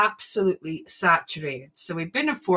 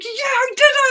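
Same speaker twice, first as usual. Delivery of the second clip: much higher, louder triumphant cry; excited cheer; 8 dB louder than usual. Retold or imitated. Boy cheering after surviving the roller coaster. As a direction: celebrate having made it.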